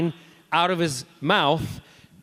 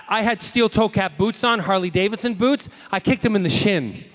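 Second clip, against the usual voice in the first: ordinary delivery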